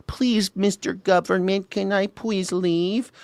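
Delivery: high, slow timid begging; pleading. Pretend. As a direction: beg meekly for permission. Mock pleading to government.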